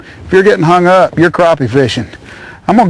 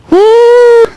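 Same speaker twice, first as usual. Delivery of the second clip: very high, loud, sustained "woo"; excited whoop; 5 dB louder than usual. Real, not acted. Angler celebrating the landed crappie, loud high whoop. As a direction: let out a high victory whoop.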